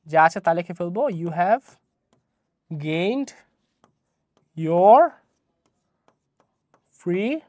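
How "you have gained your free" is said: long pauses between you have, gained, your, free; word-by-word dictation. Shown as a demonstration. Teacher writing the sentence for students, slow word-by-word dictation. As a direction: say each word alone, slowly, as if writing it.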